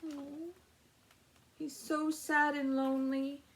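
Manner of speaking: ordinary talk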